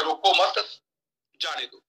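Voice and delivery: रोको मत run together, then a break; pause after मत. Shown as a demonstration. Speaker moves the comma to change meaning, pause after मत. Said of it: "अगर मैं इसी कॉमे को हटा दूं".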